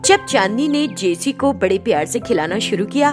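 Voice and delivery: plain reading voice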